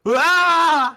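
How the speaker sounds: sudden loud, high-pitched falling scream. Acted. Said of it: "a second later you hear".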